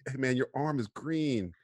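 ordinary delivery

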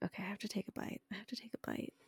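fast, hushed self-pep talk; resigned whisper. Retold or imitated. Daughter forcing herself to taste dad's dessert, whispered quickly.

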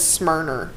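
"Smyrner" with an r sound added at the end; mimicking her Smyrna. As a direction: say "Smyrner", ending on a clear -er. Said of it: "she does not say it. She says Smyrner".

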